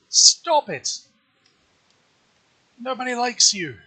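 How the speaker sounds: high, loud spiteful taunt; mean and spiteful. Real, not acted. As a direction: snap at the enemy, squeaky and mean. Taunting the guarding enemy.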